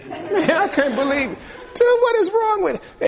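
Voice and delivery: high, laughing can't believe it; laughing disbelief. Real, not acted. Preacher laughing at the chanting Christians, high and amused. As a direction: crack up in amazement.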